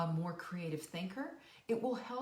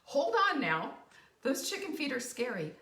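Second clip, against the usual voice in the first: mock alarm; 5 semitones higher, 6 dB louder than usual. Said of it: "Catherine says".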